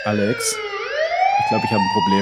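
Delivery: high, frantic panic; panicking. Acted. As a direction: freak out, voice way up. Sketch: police at the door.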